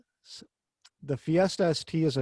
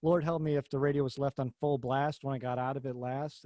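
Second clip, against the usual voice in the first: ordinary talk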